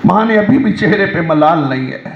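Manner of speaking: ordinary talk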